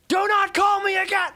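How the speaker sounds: full shouted retelling of telling her off; angry yell. Retold or imitated. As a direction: blast it down the phone. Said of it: "I yelled at her".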